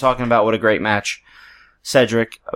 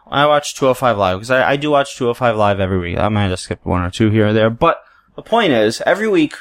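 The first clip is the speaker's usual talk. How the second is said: normal talking voice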